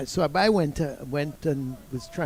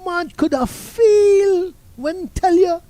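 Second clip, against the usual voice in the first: much higher, louder, slow Jamaican patter; old coach boasts about his hero, pitched way up; broad Jamaican coach; 11 dB louder, slower than usual. Retold or imitated. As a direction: lay the Jamaican accent on thick, slowly. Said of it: "He said".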